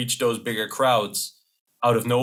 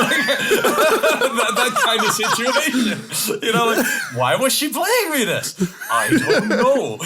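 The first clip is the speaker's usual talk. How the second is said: higher, louder words through real laughter; laughing; 11 semitones higher, 6 dB louder, slower than usual. Real, not acted. Guest laughing while voicing a confused grown kid.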